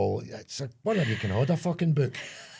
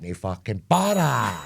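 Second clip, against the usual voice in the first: slow, smug and unbothered; smug customer; 5 dB louder, slower than usual. Pretend. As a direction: drag it out, totally cool. Voicing chipped customer, slow.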